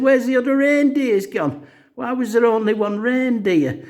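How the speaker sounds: put-on very high, upset child voice; imitated whiny small boy, pitched right up; little Kevin's voice. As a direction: mimic a distressed little kid asking. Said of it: "and then Kevin's crying".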